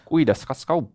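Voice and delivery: playful Estonian with a laugh; Estonian phrase. Acted. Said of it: "That was actually real Estonian language".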